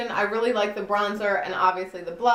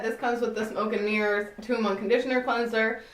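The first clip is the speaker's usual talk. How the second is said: ordinary talk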